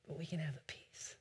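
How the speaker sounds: near-voiceless, low whisper; whispered assurance. Real, not acted. Preacher assures church of peace in storms, whispering. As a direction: drop right down to a hushed whisper.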